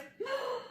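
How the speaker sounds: sharp high-pitched gasp; a quick shocked intake of breath. Acted. Narrator reacting to the snatched hat, high gasp.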